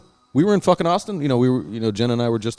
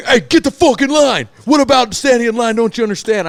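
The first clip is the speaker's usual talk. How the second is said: much higher and louder imitated bark; bouncer yelling; 12 semitones higher, 7 dB louder than usual. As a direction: mimic a bouncer screaming get in line. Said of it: "I get yelled at by the other bouncer".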